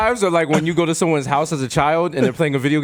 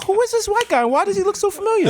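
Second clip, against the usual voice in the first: high confused voice; faster than usual. Retold or imitated. Retold confused reaction, high voice.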